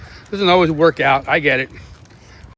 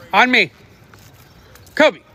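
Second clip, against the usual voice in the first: raised voice calling the dog back; 6 semitones higher, 6 dB louder than usual. Real, not acted. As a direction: call firmly across the park.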